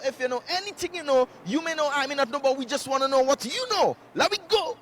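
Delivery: rally the viewers with a sing-out host pitch; hyped show intro. Acted. Host opening his Toronto street quiz, hyped announcing.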